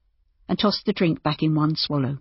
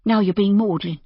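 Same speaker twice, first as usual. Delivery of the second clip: higher woman's character voice, no-nonsense; practical woman innkeeper; 4 semitones higher than usual. A narrator's reading. Innkeeper telling the doctor to stay, practical. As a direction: take charge of him kindly, pitched up. Narrator voices Judy chiding.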